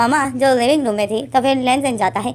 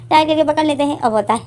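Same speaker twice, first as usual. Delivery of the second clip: ordinary delivery, nothing marked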